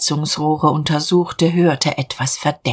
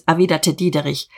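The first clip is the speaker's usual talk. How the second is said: plain reading voice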